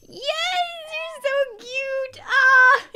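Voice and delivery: high, drawn-out cute-pet squeal; gushing squeal. Real, not acted. Streamer scratching the cat's ear, gushing squeal. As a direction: squeal with delight, ending in a loud ah.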